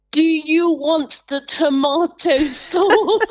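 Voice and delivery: mock talking down to an old woman; patronising helper. Imitating people who patronise her mum. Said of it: "people sort of go".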